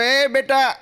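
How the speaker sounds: high, loud call to a vendor; mock hail across the platform; hammed-up shout for the soda boy. Old man calls soda seller.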